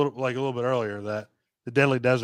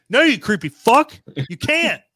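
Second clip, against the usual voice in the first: higher and louder, yelling at the screen; outraged shout at a movie character; angry yell; 8 semitones higher, 7 dB louder than usual. Acted. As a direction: tell the creepy kid off at full volume.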